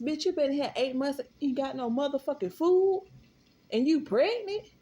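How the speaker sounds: outraged shout. Real, not acted. Outraged on girl's behalf.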